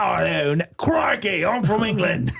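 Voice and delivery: high protesting fake Aussie accent; Australian-sounding British. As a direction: insist in a badly faked British-Australian accent. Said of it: "Now he's Australian".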